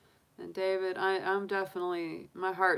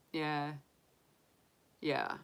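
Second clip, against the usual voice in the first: ordinary delivery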